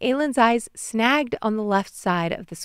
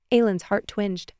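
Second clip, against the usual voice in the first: ordinary delivery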